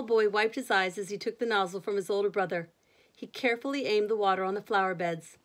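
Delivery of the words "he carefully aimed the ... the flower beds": ordinary delivery, nothing marked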